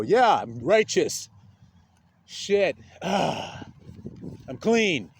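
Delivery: slow, self-satisfied, x0.5 pace; smug hypocrite. Retold or imitated. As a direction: drag it out, pleased with yourself. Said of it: "and they go".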